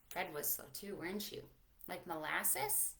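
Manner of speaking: ordinary delivery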